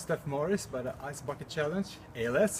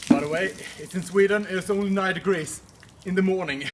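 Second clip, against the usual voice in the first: much louder, higher call across distance; 5 semitones higher, 10 dB louder than usual. Real, not acted. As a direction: shout it out to the camera far away. Calling out to the distant camera.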